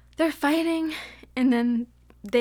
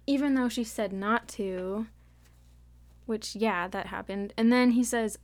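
normal talking voice

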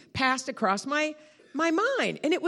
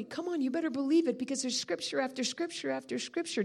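ordinary delivery

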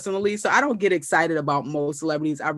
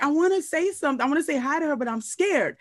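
nervous fan; 7 semitones higher than usual. Retold or imitated. Retold self nervous fan.

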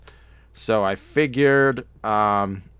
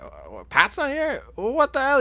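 high-pitched incredulous quote; imitating someone taken aback, pitched way up; mock disbelief. Acts Bob's surprise at Pat's absence.

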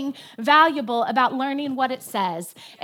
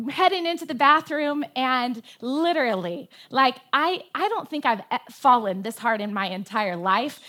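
ordinary talk

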